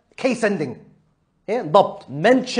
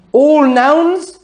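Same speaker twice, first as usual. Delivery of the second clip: All nouns louder and higher, All stressed; emphatic repeat; 7 semitones higher, 6 dB louder than usual. Shown as a demonstration. Teacher repeating the rule emphatically to the student. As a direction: hit All nouns hard. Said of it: "All nouns in its original form".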